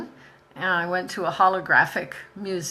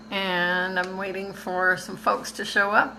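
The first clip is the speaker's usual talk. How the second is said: ordinary talk, nothing marked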